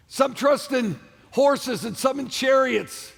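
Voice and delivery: slow, drawn-out shout over the music; slow preached shout. Real, not acted. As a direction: call it out slowly to the hall.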